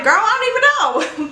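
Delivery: exasperated. Retold or imitated. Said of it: "And I'm like".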